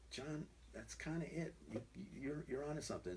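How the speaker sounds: a hint of a gentle father, softly; hushed fatherly voice. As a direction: quietly tell a child he's onto something. Father quietly encouraging son's prayer.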